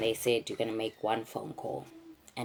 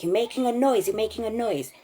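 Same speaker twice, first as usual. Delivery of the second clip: much higher, louder repeated shout; retold angry yell; 10 semitones higher, 6 dB louder than usual. Kidnappers yelling at her to stop crying, loud retold shout. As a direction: bark it at the crying hostage.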